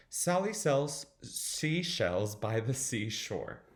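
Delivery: slow, careful recitation; slow tongue twister. Shown as a demonstration. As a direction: pick through the tongue twister slowly. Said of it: "was having a hard time thinking about it".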